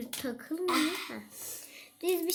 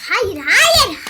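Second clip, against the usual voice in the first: loud, high insistent no; shouting; 5 semitones higher, 15 dB louder than usual. Real, not acted. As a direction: yell the denial. Insisting against sister.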